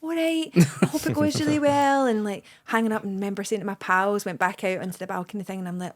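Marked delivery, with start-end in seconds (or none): 0.0-2.0 high, tender well-wishing; naive softie. Retold or imitated. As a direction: mimic a naive softie, pitched right up. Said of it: "a big softie and quite naive".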